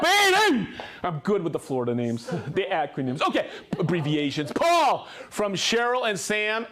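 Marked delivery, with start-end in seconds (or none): 0.0-0.7 high, loud celebratory cheer; excited shouted cheer; triumphant whoop. Real, not acted. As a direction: whoop it like you just won. Cheering own success with town name.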